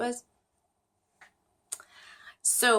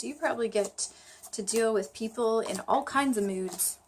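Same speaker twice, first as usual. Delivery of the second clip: ordinary talk, nothing marked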